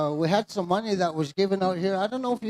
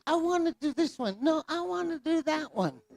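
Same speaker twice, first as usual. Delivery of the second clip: put-on kids squabbling; 7 semitones higher, 4 dB quieter than usual. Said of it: "They just".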